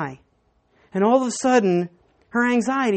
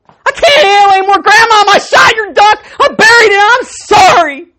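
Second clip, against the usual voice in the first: much higher and louder, crying it out; tearful confession; 12 semitones higher, 13 dB louder than usual. Retold or imitated. Boy to grandma after a week of guilt, wailing through tears. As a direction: break down and blurt the whole confession.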